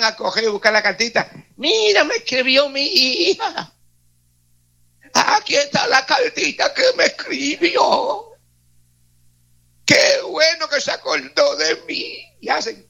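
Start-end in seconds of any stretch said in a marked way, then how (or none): 1.6-12.4 put-on high, slow, tearful old parent's voice; an old father weeping over his child's letter. Preacher acting a parent moved to tears by a letter, high, slow and weeping.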